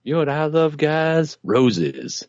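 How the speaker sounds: lightly voiced creep, unusual timbre; sleazy pickup line. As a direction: pitch it like a creep.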